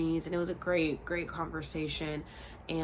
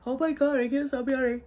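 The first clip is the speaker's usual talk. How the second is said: put-on yawning girl; 8 semitones higher, 7 dB louder than usual. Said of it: "she's like".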